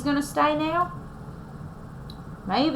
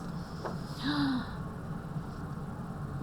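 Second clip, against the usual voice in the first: whispered gasp; 3 semitones higher, 13 dB quieter than usual. Acted.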